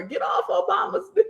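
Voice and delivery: high laughing shout; laughing retort. Retold or imitated. Telling someone off online, laughing shout. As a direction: fire it back at her while cracking up.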